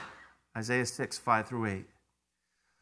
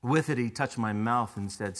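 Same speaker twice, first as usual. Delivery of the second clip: ordinary talk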